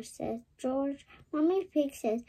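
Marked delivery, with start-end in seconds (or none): none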